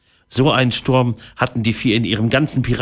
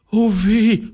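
high-pitched moan of woe; groaned lament; 12 semitones higher than usual. Acted. Second pirate caught in the storm, groaning. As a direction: let out an acted, high groan. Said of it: "stöhnte Holzbein".

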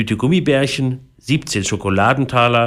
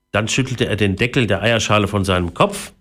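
plain reading voice

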